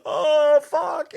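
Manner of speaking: high, startled "oh fuck"; dismayed yelp. Retold or imitated. After running over a snake, a high dismayed yelp. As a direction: squeal it out as the snake writhes.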